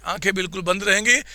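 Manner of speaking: ordinary delivery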